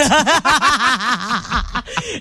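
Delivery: bright high laugh. Real, not acted. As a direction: laugh at your own joke. Laughing at own joke.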